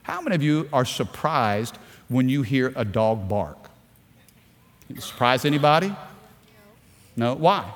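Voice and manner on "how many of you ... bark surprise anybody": ordinary delivery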